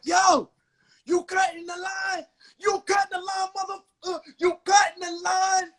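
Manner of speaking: high, strained mock shout; yelling drunk man. Drunk stranger accusing a man in line, yelling. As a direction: holler it high like an irate drunk. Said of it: "He's yelling at people".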